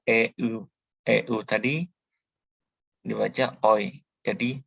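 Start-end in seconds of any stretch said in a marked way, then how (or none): none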